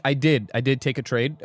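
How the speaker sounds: ordinary talk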